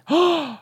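high, sharp mock gasp; dramatic gasp. Podcast host reacting to the month of July, dramatic gasp. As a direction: suck in air with feigned excitement.

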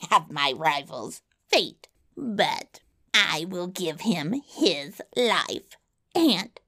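slow, swooping villain voice with cackles; wicked little monster, pitch lurching about; gloating yellow dwarf. A narrator's reading. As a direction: gloat slowly, chuckling between phrases. Yellow dwarf gloats, sets terms.

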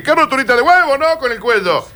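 high exasperated jab; mock scolding. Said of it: "le dije". (reto burlón agudo)

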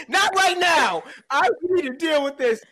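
high-pitched hammed-up fussing; mock indignant diner. Diner fending off someone before the waiter, mock indignant. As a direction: make a pretend scene in a high voice.

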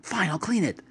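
high-pitched, exasperated giving in; frustrated. Retold or imitated. Fussy roommate caving in over the mess, acted frustration. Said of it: "he gets frustrated. He goes".

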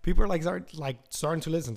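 normal talking voice

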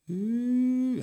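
high, sustained "ooh" at modest volume; thin held hoot, rising slightly; faint eerie noise. Retold or imitated. Storyteller imitating the noise heard under the rug, faint high ooh. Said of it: "it was just faint then".

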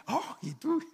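very high imitated retort; mock surprise. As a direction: jump way up in pitch for a mock reply. Retold reply in a joking anecdote. (réplica imitada muy aguda)

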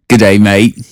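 mock Australian greeting; Australian accent. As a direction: put on a playful Aussie accent. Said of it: "they're like".

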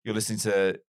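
radio DJ intro. Retold or imitated. Imitating the cheesy DJ opening his breakfast show. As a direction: announce it like a cheesy radio jingle. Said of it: "he would start a show going".

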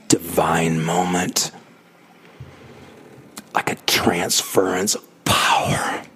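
slow, hushed whisper; slow reverent whisper; awed whisper. Real, not acted. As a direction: whisper it like something sacred. Awed memory of mic shock.

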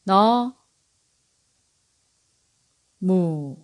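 너 and 무 split apart with a long pause between; syllable by syllable. Shown as a demonstration. Teacher splitting 너무 into syllables for learners. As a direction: break 너무 into its two syllables, 너 then 무. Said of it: "One more time".

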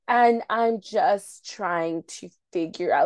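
drawn-out mock pondering; slow talking. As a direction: imitate an annoyingly slow talker. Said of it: "you're talking slow".

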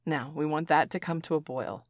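ordinary delivery

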